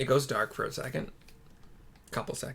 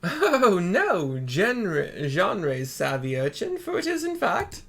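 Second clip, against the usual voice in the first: loud, higher hammed-up turtle; Genbu voice; 6 semitones higher, 7 dB louder, slower than usual. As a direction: correct the child with gusto. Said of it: "says Genbu".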